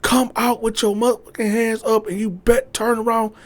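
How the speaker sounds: police threatening the woman, high; threatening cops. Retold or imitated. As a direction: threaten her coldly. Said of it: "So they threatening her".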